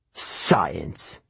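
low, scornful echo; scoffing. A narrator's reading. Camp director dismisses the boy's argument, scoffing. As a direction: drop the pitch and scoff. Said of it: "Mister D scoffed".

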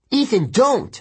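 high, urgent call; urgent cry. Acted. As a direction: call out to stop a friend. Narrator cries out to stop Ethan.

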